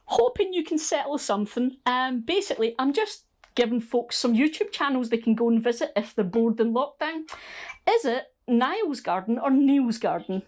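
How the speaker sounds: chirpy and upbeat, greeting a friend live on camera; bright phone voice. Real, not acted.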